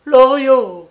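loud, high, desperate call; old mother's piercing-pitched cry. A narrator's reading. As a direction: shout your son's name in alarm. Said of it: "criait la vieille".

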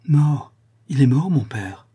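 usual speaking voice

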